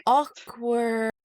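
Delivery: comically uncomfortable; mock awkwardness. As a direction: stretch it out in singsong discomfort. Voices Azriel's discomfort at breakfast.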